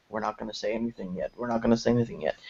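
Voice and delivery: quick, soft, hushed mock secrecy; quiet, hurried mock studio line. Studios keeping quiet, mock quote.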